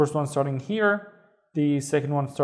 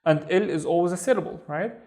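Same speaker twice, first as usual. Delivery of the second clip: normal talking voice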